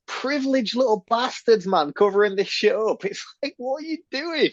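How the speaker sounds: very high, exasperated mock scolding; incredulous outrage. Podcaster berating characters for covering this up, exasperated. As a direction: squeal in comic indignation at them.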